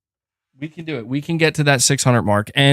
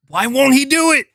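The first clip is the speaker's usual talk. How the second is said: high-pitched, frustrated wail; exasperated; 11 semitones higher than usual. Real, not acted. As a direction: cry out in exasperation, pitch shooting up. Exasperated that cat stays silent.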